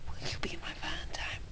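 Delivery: breathy hushed whisper; mock-romantic whisper right into his ear. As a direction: lean in and whisper the question. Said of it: "closer and closer to Cry's ear".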